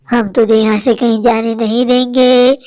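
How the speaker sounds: block her escape in a very low ghost voice; low menacing haunting voice; threatening ghost. Acted. Ghost refuses to let her go.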